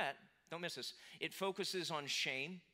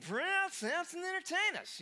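much higher, loud, mock drunken holler; drunken shout; 12 semitones higher, 7 dB louder than usual. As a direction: yell it like a rowdy drunk in the stands. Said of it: "They shouted, uh, probably slurred".

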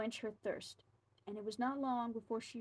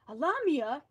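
higher, much louder shocked outcry; shocked cry; 4 semitones higher, 9 dB louder than usual. Acted. Governor hearing his wife is a snake woman, shocked cry. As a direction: cry it out in disbelief. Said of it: "he cried".

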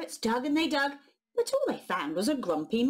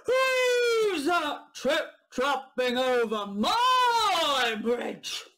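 slow, loud, drawn-out roar; troll roar; 4 semitones higher, 4 dB louder, much slower than usual. Acted. Troll roaring at whoever crosses his bridge. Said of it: "he bellowed".

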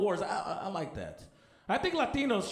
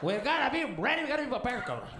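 panicked immigrant dad; 6 semitones higher than usual. Retold or imitated. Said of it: "immigrant dad voiced in story".

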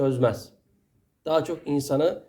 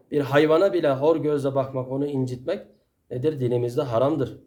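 ordinary delivery

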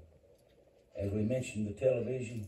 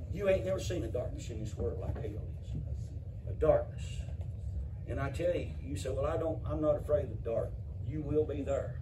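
preaching at full force; 4 semitones higher than usual. Acted. Preacher to his church, crying out about hell at full force. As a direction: drive it home to the congregation, full force.

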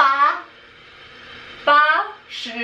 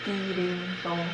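stage whisper; 8 semitones lower, 10 dB quieter, slower than usual. Pretend. Whispered guessing game clue.

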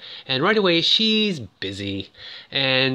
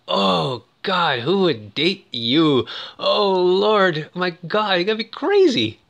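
mock-appalled jeer, pitched up; 6 semitones higher, 3 dB louder than usual. The gang mocks him for asking her out, exaggerated mocking disbelief. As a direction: imitate a gang's drawn-out ridicule. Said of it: "all just mocking him like".

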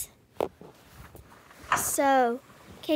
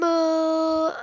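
ordinary talk

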